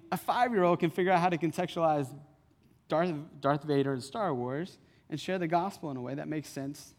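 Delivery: ordinary talk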